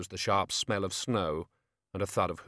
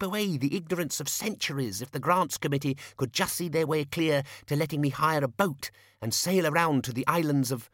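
higher-pitched academic making his pitch; eager young wizard scholar; 5 semitones higher than usual. Acted. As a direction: lecture your superior in a higher, eager voice.

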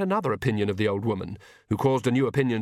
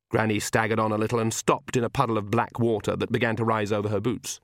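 ordinary delivery, nothing marked

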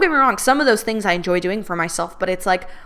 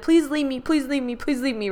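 put-on childish pleading; 4 semitones higher, 4 dB quieter than usual. Retelling her childhood pleading.

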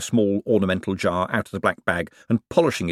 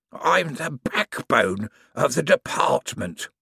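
a shade proudly; 6 semitones higher, slower than usual. A narrator's reading. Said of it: "a shade proudly".

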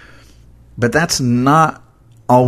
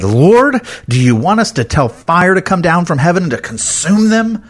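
angry outburst; 8 semitones higher than usual. Retold or imitated. Disciples begging Jesus to burn the village, angry shout. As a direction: burst out demanding fire from heaven. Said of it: "They're so angry".

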